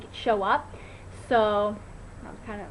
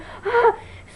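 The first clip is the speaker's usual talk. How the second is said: a squealing rising laugh; high giddy laugh; 13 semitones higher, 6 dB louder than usual. Real, not acted. Hoping the blue dye stays, high giddy laugh.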